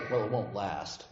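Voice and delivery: deadpan the gloomy Mainer's retort; the Mainer grumbling back at the cheerful neighbour; grumpy Mainer. Retold or imitated. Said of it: "Talk to any Mainer about the weather".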